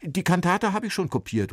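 put-on a young copyist calling out confused. A narrator's reading. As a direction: call out flustered. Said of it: "ruft er verwirrt".

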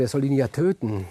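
ordinary delivery, nothing marked

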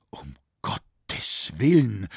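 slow, stretched-out exclamation of dismay; pained cry. A narrator's reading. Franz recognises the wretched girl, pained exclamation. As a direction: draw the words out in pain. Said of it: "rief jetzt Franz mit schmerzlichem Tone".

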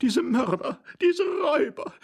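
very high, outraged female cry; high-pitched accusing wail; lady's scream. A narrator's reading. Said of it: "schrie die Dame".

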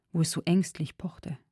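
ordinary delivery

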